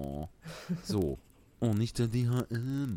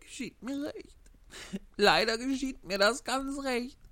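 weeping Pinocchio; 12 semitones higher than usual. Acted. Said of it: "und sagte weinend".

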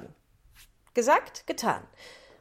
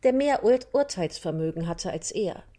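ordinary delivery

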